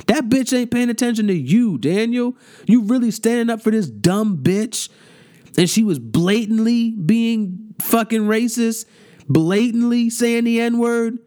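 high-pitched, incredulous outrage; indignant disbelief. Real, not acted. Ranting at Daniel for standing up for her, indignant. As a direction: call them out, pitch way up in disbelief. Indignant rant at singer defending someone.

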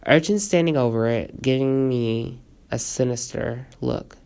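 plain reading voice

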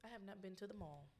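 ordinary delivery

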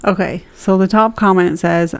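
ordinary talk

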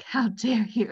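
whispered, mock-offended; whispered indignation. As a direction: breathe it like a betrayed toddler. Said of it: "them just being like".

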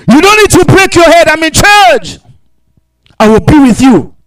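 high, loud preacher's declaration; forceful proclamation. Acted. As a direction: thunder God's promise to the congregation. Proclaims God's assurance at full force.

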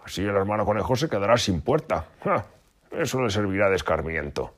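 high, loud gleeful fox; gloating. A narrator's reading. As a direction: crow over revenge. Fox gloats about revenge.

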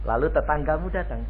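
normal talking voice

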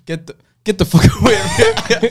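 high, laughing mock yell; mock shout. Stranger yelling at the prankster, high laughing shout. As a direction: shove him off with a shout.

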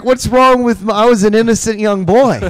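high, loud mock protest of innocence; mock indignant. Roasted by friends, he protests his innocence loudly. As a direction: play the wronged innocent, loudly.

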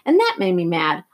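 ordinary delivery, nothing marked

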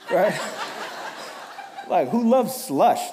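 laughing through the question. Real, not acted. Teasing a friend who loves slush, laughing.

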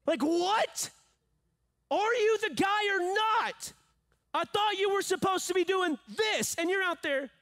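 high-pitched, exasperated rant; indignant John the Baptist. Acted. Preacher as John confronting Jesus, high indignant outburst. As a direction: demand answers, pitch pushed way up. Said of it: "John's like".

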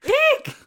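very high shrill eek like a horror violin; shrieking eek. Pretend. Mimicking a slasher film stab for the co-host, high squeal. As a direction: shriek it like the Psycho strings.

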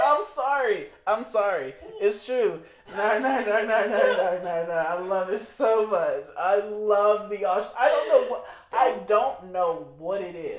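loud, emphatic protest of adoration; gushing insistence. Real, not acted. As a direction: defend your favorite over the objections, loudly. Insisting on love of Australian accent.

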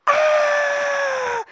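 a sudden high, loud yelp of pain; high pained shout. Retold or imitated. Ace speared in the leg, high loud yelp. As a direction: scream like a spear just hit your leg. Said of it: "he's like".

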